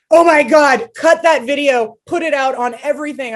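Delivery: loud, high thrilled outburst; excited cheer. Retold or imitated. Cheering on the president's shove, thrilled. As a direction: gush like a thrilled fan. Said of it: "And I was like".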